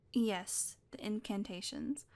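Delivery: normal talking voice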